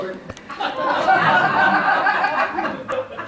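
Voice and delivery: high airy laughter; breathy laugh. Real, not acted. Laughs at husband's joke.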